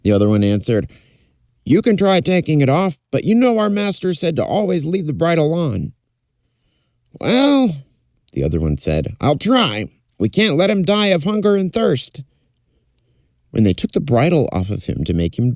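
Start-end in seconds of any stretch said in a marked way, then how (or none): 1.6-5.9 put-on higher young lad's voice; a simple stable hand, higher up; stable boy voice. Other stable boy answers. 7.2-7.8 put-on higher young lad's voice; a simple stable hand, higher up; stable boy voice. 9.2-12.2 put-on stable boy voice.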